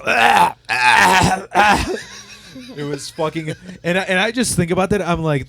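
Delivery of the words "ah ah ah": high, loud sighs of sauna bliss; relaxed sighs. Retold or imitated. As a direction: breathe out satisfied ahs. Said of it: "He's like".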